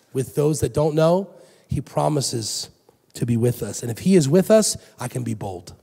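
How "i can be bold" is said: normal talking voice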